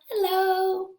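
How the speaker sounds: lightly voiced timid squirrel. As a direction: greet as the shy squirrel. Puppet squirrel greets.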